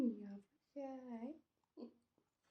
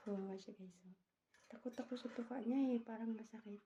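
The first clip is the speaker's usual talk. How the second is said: usual speaking voice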